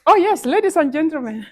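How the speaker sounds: high, loud exclamation of happy discovery; delighted correction. Real, not acted. Speaker greets the gentlemen after all, surprised and delighted.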